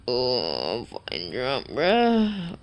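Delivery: a hint of a drunk guy; mock drunk. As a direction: play a sloppy drunk bro. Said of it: "this is what I say when I'm drunk".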